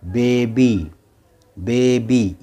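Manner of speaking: बेबी with a drawn-out ee ending; final y as ee. Shown as a demonstration. Teacher models बेबी for learners, final y as ee. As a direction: say बेबी with y sounded as ee. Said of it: "ई की आवाज़".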